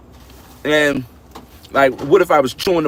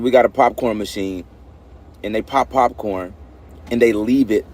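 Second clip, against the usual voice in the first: normal talking voice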